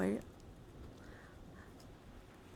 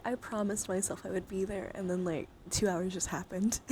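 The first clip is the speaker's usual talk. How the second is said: ordinary talk, nothing marked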